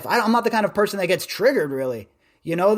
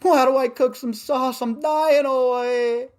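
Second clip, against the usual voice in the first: put-on high, stretched-out helpless kid voice; mock desperate whine, higher and slowed; whiny plea to mom; 7 semitones higher, slower than usual. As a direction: beg your mom like a starving teenager. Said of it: "I'm like".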